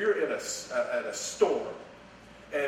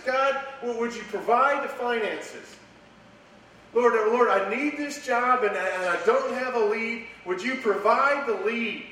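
much higher and louder, begging; pleading prayer; 8 semitones higher, 5 dB louder than usual. Pretend. Preacher imitating a believer begging God for a job, high and loud. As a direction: beg God for help like a worried believer. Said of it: "There's no way I have the finances".

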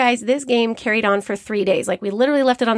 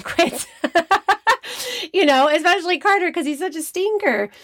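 laughing; 8 semitones higher than usual. Real, not acted. Laughing about competitive son.

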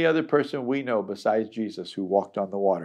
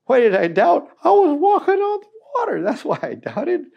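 high, fast, loud protest; mock indignant Peter; 6 dB louder than usual. Preacher voices a defensive Peter, high and squeaky. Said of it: "some people might say".

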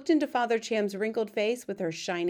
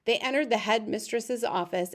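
ordinary delivery, nothing marked

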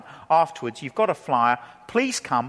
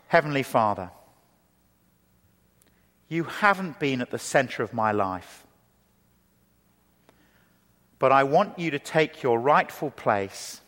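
slow, soft and lower, long pauses between phrases; 5 semitones lower, 3 dB quieter than usual. Shown as a demonstration. Preacher leads the congregation in prayer, slow and soft. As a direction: lead the prayer gently, phrase by phrase, with room to echo. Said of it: "Let's say it slowly now, phrase by phrase".

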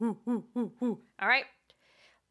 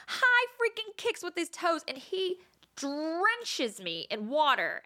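excited; 7 semitones higher, slower than usual. Real, not acted. Excited retelling.

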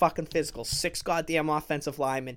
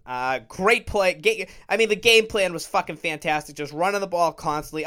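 ordinary talk

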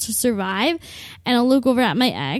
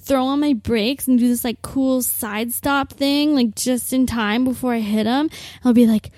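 ordinary talk

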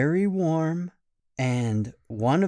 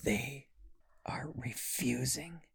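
hushed, breathy whisper of alarm; scared whisper; 10 dB quieter, slower than usual. Acted. Kids whispering to each other, spooked by the parents. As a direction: whisper like the monsters might hear.

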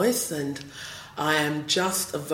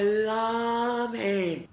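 slow, higher-pitched declaration of adoration; drawn-out gushing; 7 semitones higher than usual. Real, not acted. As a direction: stretch LOVE out long and high. Gushing over an unfashionable favourite singer.

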